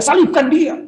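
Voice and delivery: high-pitched mock crowd cry; imitated crowd shout. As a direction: call it out like one of the mob. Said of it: "Ketika orang teriak-teriak". (menirukan teriakan orang banyak)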